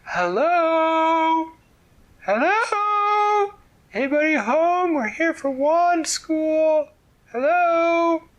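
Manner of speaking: loud high calls across the moat; shouting. A narrator's reading. Girls shouting up at the closed castle, loud calls. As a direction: call out loudly to the castle. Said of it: "and they shouted".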